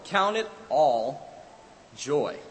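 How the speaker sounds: "Count it all joy" stretched slowly, word by word; slow, deliberate. Acted. Preacher repeats verse two ways.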